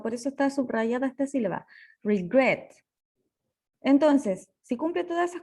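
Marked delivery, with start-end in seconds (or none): 2.0-2.7 "regret" with the stress on its final syllable; stress on last syllable. Shown as a demonstration. Teacher showing the accented syllable of "regret", stressed on the last. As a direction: say "regret" leaning on the second syllable, -gret. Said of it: "el acento recae en la última sílaba".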